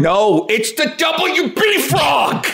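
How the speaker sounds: high, slow, cracked cry of outrage; indignant correction. Pretend. Fan correcting friend's UPN frog mistake, hammed-up indignant wail. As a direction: wail the correction, stretching each word.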